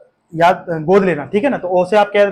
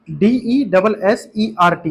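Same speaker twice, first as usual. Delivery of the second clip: 1 spelled out slowly; much slower than usual. Shown as a demonstration. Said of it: "इसका जो pronunciation है ना".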